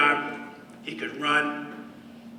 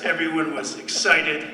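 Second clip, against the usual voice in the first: usual speaking voice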